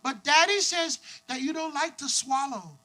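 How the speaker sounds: put-on high, slow child voice; little girl. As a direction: innocent small-girl report to mom. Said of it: "this girl looks at her mom".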